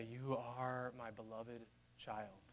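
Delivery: very soft, slow, hushed welcome; God welcoming his child home, hushed and tender; tender father. Acted. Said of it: "Hearing God say".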